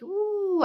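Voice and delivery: high, long cooing "ooh"; soothed "ooh". Retold or imitated. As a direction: coo with pleasure, high and drawn out. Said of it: "I felt quite calm".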